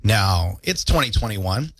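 normal talking voice

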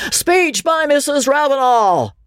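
high, sustained announcing holler; shout. A narrator's reading. Man demands a speech from Mrs. Ravenel, shouting. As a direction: shout it out to rally the room. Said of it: "shouted the man".

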